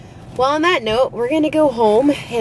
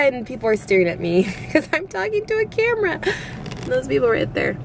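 ordinary talk

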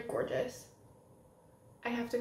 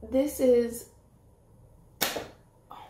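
much louder excited exclamation; freaking out; 9 dB louder than usual. Real, not acted. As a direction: burst out, thrilled. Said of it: "Now back to freaking out".

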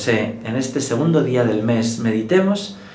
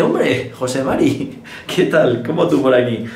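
surprised greeting; slower than usual. Acted. San José running into José Mari at heaven, surprised greeting. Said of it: "aparece San José y dice".

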